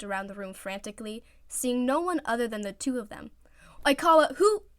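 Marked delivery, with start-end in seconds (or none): none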